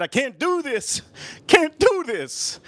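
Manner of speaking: high, slow, drawn-out whine; acted high-pitched despair; mock breakdown. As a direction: wail it like a kid giving up. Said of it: "I almost broke down".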